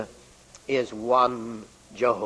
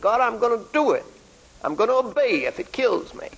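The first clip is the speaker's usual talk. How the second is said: much higher, pushed out through clenched teeth; gritted-teeth vow; 9 semitones higher, faster than usual. Retold or imitated. Preacher voicing a believer's stubborn vow to God, through gritted teeth. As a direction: swear it to God like a man straining at his limit. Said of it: "gritted your teeth and said".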